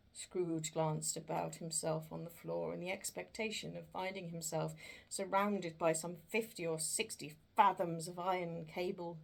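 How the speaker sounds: ordinary delivery, nothing marked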